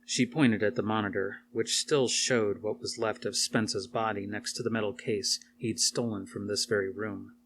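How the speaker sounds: usual speaking voice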